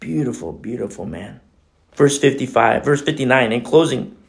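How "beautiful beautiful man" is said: hushed awe. Real, not acted. Awed repeat about Stephen.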